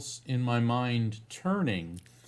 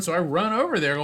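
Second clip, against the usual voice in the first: ordinary talk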